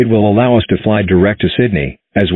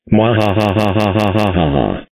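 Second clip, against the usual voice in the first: slow evil cackle; villainous mwahaha, drawn out; mad scientist laugh. Acted. Villain plotting a prank.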